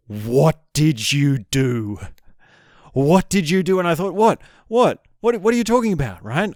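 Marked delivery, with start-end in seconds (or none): 0.1-2.0 slow, drawn-out accusation; accusing. Retold or imitated. Girlfriend confronting him after the dog walk, slow accusation. 2.9-3.8 higher-pitched mock accusation; accusing repeat. Girlfriend demanding an answer, imitated in raised pitch. 4.1-6.2 high, rapid-fire mock innocence; flustered denial. Caught boyfriend trying to deny anything, high flustered protest. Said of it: "I'm just trying to deny anything".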